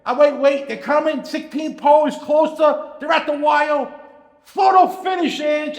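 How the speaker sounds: high, loud, building to the finish; mock play-by-play peaking in a shout; imitated race call. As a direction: call the closing stretch excitedly down the phone.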